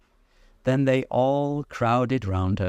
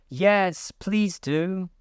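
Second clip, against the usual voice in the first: chorus of animals; 10 semitones higher, much slower than usual. A narrator's reading. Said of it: "the others all joined in chorus".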